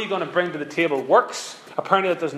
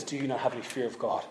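soft, low, hushed plea to Christ; the repentant criminal rebukes his fellow, hushed and awed; 4 semitones lower, 7 dB quieter than usual. Retold or imitated. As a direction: breathe it out in awe, softly. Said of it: "is shocked and says".

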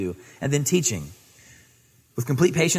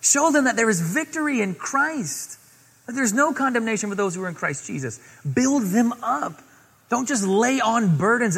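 higher, pleading exhortation; earnest higher urging; Paul urging Timothy; 9 semitones higher than usual. Acted. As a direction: encourage him like a mentor. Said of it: "No, he says".

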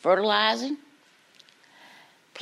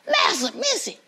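high-pitched urgent call; calling out urgently; 12 semitones higher than usual. Acted. Old John alerting the parents, high urgent calling. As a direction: call them from far up the hill.

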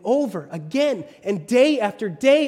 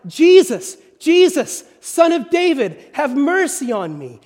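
desperate full shout, much higher and louder; 7 semitones higher, 8 dB louder than usual. Retold or imitated. Beggar shouting even louder for Jesus, desperate cry. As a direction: beg at the top of your lungs. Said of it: "he shouted even louder".

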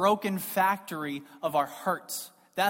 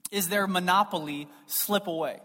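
normal talking voice

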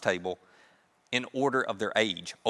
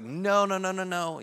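hard-hearted refusal; 5 semitones higher than usual. Acted. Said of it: "he's so hard-hearted".

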